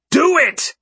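high-pitched, explosive scream; loud scream. A narrator's reading. Man screams at the gunman to do it, loud and high. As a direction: scream it out all at once. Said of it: "how loud I scream".

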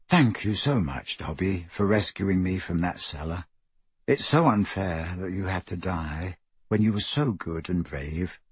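soft, low, gentle eulogy; tender graveside goodbye, voice held low; mild sorrowful farewell. A narrator's reading. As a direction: quietly grieve with understated sadness.

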